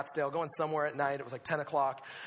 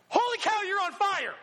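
high, loud yell of disbelief; alarmed shout; 13 semitones higher, 7 dB louder than usual. Retold or imitated. Preacher retelling spotting the burning rider, alarmed shout.

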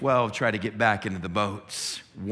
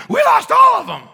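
frantic, very high, louder babbling; panicked herders; 16 dB louder than usual. Acted. Young herders telling the town they lost the pigs, frantic. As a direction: blurt it out breathless with panic.

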